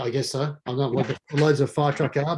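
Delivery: ordinary delivery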